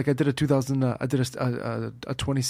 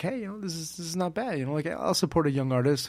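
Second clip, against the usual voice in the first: imagined listener's reaction; 5 semitones higher than usual. Pretend.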